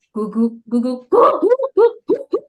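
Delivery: dog barking. Shown as a demonstration. Trainer showing participants answering with animal sounds, mimicked dog barks. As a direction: bark like a playful dog. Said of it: "dia menjawab membunyikan".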